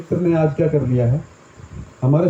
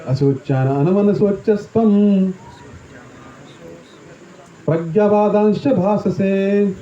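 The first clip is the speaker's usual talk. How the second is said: slow verse recitation; slower than usual. Acted. Preacher to listeners, slow sacred verse chanting. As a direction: chant the shloka slowly and deliberately.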